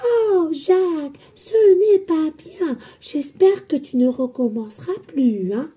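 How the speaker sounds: put-on high, slow, sugary scolding of a child; mock gentle parent. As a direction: parody an overly sweet mother. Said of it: "Vous croyez qu'ils disent".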